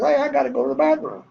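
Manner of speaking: high-pitched wife voice; imitated wife. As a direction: pipe up high like the wife needing a break. Imitates wife interrupting the tour.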